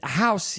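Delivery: "house" said as "housey"; "house" with a Brazilian extra syllable on the end; extra final vowel. Shown as a demonstration. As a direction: add an ee sound after "house". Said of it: "It doesn't go housey".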